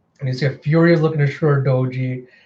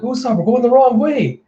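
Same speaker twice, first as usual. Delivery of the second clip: alarmed protest; 9 semitones higher, 4 dB louder than usual. Retold or imitated. Acted worried character protest.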